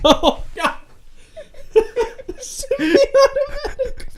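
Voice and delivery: high squealing laughter; laughing. Real, not acted. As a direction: laugh through the word. Laughing at the joke.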